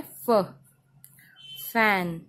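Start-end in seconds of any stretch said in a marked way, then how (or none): 0.0-0.3 "फ" as an isolated fuh; phonic F sound. Shown as a demonstration. Teacher showing the sound of F, "फ" sounded out. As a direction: sound out "फ" with lip-teeth friction, fuh. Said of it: "एफ का sound होता है".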